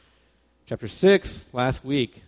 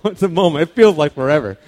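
laughing through the words, a bit louder; 5 semitones higher, 13 dB louder than usual. Real, not acted. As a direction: chuckle while recalling the grenade. Laughing at grenade memory.

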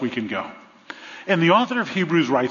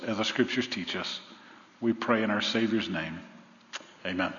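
prayer; 2 semitones lower than usual. Acted. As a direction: lead the church in grateful prayer. Opening prayer before the sermon.

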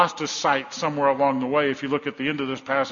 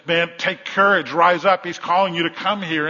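louder, higher, rousing call; urging crowd; 3 semitones higher, 5 dB louder than usual. Retold or imitated. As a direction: call out encouragement to someone. Crowd urgently encourages the blind man.

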